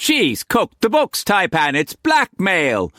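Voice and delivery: high, indignant accusing; Quance voice. A narrator's reading. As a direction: point the finger.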